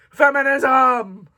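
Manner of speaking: "Feminism!" drawn out loud and slow; slow mock cheer. Booktuber repeats the cheer slowly. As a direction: stretch the cheer out.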